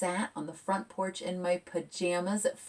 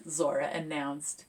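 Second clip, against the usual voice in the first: lively picture-book read; reading to children; 3 dB louder than usual. A narrator's reading. Author reading her picture book aloud to kids, lively. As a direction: read it bright and expressive.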